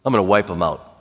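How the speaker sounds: low, understated threat; God. Acted. Deity responding to the people's murmuring, low and grave. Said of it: "God's like".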